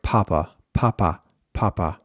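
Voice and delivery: Spanish with English puffed p in papa; English-accented Spanish, aspirated p; heavy English accent. Shown as a demonstration. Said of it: "more heavily accented English pronunciation".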